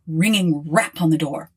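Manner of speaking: ordinary delivery, nothing marked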